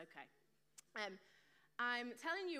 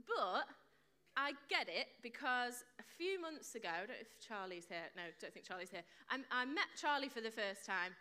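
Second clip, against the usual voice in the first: ordinary talk, nothing marked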